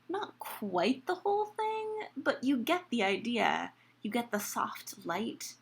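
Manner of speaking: usual speaking voice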